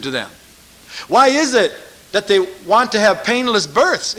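loud, driving preacher's challenge; fiery preaching. Acted. Preacher challenging the congregation, loud and pressing.

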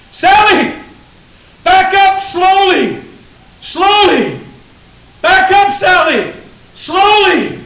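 very high, very loud alarmed father's cry; panicked shout. Acted. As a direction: scream a warning to freeze your child. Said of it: "instructions from that voice".

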